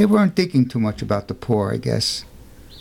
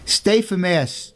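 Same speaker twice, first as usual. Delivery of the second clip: higher, slow and gentle invitation; gently; 6 semitones higher, slower than usual. Acted. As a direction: kindly coax the boy to stay. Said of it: "The priest said gently".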